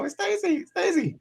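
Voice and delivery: high-pitched mock accented greeting. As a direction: put on a high-voiced star's accent. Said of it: "that J Lo".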